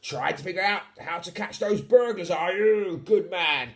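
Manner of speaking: lightly voiced old pirate; a touch of a sea captain. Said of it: "a voice whisper in his ear".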